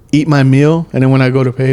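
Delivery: usual speaking voice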